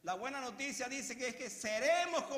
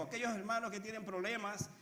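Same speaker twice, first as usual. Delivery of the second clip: ordinary delivery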